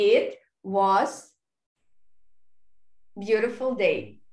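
"It was", long pause, then "beautiful day"; pause for missing article. Shown as a demonstration. Teacher isolating the missing article, pausing after "was". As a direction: leave a long gap between "was" and "beautiful". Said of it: "use your fingers to isolate".

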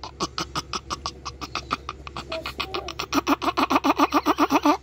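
long whispery villain cackle; evil laugh. Acted.

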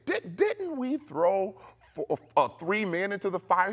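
retold king stumbling in amazement; astonished king. King looking down into the furnace, astonished. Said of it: "he said".

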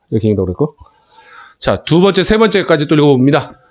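ordinary talk

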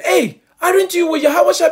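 put-on very high, loud girl's voice; a girl calling Peter out, loud and shrill-pitched; accusing girl.

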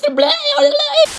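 very high, mock-crying whine; whining commenter. Imitating commenters who complain about swearing, high whiny wail. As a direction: wail it like an upset child, hammed up. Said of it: "you want me to show you?".

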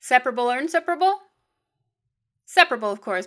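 usual speaking voice

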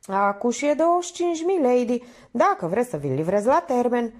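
loud, fast, high announcement; thundered. A narrator's reading. Painter suddenly thunders a new price at Minerva, loud and fast. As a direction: boom the price out of the blue. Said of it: "tună din senin".

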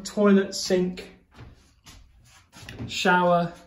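ordinary talk, nothing marked

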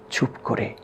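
a hushed, sinking close; fading to near silence. A narrator's reading. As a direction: let it die into silence. Falling silent in the poem.

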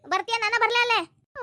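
high-pitched threatening call; impatient calling. Acted. Grandmother threatens to come over, impatient calling. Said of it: "ಯಾಕ್ ಹಂಗ್ ಕೂಗಾಕತ್ತಿದಿ".

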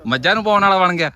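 loud, laughing jab at a friend; hearty loud banter. Real, not acted. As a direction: boom it out like a joke among friends. Loud joking about the mix. (ਉੱਚੀ ਆਵਾਜ਼ ਵਿੱਚ ਮਜ਼ਾਕ)

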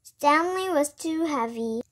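ordinary delivery

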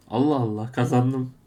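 loud, startled cry of disbelief; surprised win. Real, not acted. Streamer finally winning a case, loud surprised cry. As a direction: shout it out, amazed he won.

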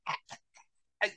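breathless voiceless choking gasps; strangled gasp. Retold or imitated. [name] imitating a strangled man, voiceless gasping. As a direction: imitate a man being throttled, no voice. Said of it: "like, all scre".

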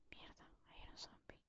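urgent unvoiced whisper; hiss-free hushed alarm; startled whisper. Real, not acted. Spots a zombie while hiding.

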